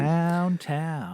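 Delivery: slow quiet drawn-out echo; soft echo. Pretend. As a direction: echo it softly and slowly. Echoing partner in odd voice.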